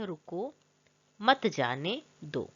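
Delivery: low, slow example sentence, words set apart; slow rereading. Shown as a demonstration. Teacher rereads the same sentence, slow and low. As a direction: read it slowly, pausing between words. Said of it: "इसी sentence को फिर से".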